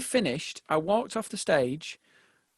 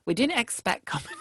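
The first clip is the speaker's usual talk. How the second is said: put-on old lady; 5 semitones higher than usual. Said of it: "this old dear who sort of said".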